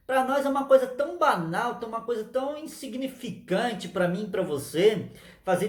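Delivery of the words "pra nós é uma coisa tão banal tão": ordinary talk, nothing marked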